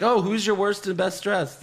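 high, sing-song mockery; mock their cheesy award questions. Mocking the rival show's awards.

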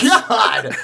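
loud, high cry through laughter; real laughing outburst; laughing exclamation. Real, not acted. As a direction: blurt it out mid-laugh.

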